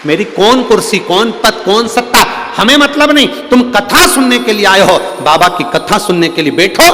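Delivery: high, loud, driving sermon voice; fervent preaching. Acted. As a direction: hammer the lesson home to the crowd. Preaches at full force.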